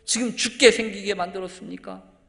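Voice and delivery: loud, high-pitched shouted protest; building from indignant grumble to yelling accusation; angry, resentful complaint. Acted. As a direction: cry out like disciples on a sinking boat. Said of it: "한층 화가 났어요. 불평도 하고 원망이".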